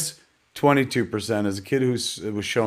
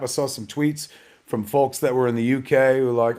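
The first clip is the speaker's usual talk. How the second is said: normal talking voice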